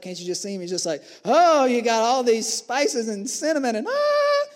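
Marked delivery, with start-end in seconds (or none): 1.2-4.4 higher, louder, gushing lover; worked-up lover. Retold or imitated. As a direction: swept away by her fragrance. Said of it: "He's getting all worked up".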